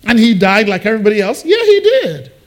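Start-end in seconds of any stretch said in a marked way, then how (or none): 1.4-2.3 pitched way up, punchy; emphatic confirmation. Shown as a demonstration. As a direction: answer high and emphatic. Answers his own challenge, raised voice.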